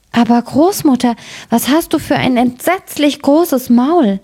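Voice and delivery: put-on high-pitched childlike amazement; alarmed little girl. A narrator's reading. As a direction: raise the pitch in growing alarm. Girl alarmed at disguised wolf.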